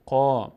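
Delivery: قا with a full, dark, rounded "qaw", held long; heavy qaf. Shown as a demonstration. Teacher showing a heavily read letter, heavy vowel on قا.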